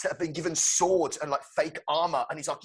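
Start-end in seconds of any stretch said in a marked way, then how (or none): none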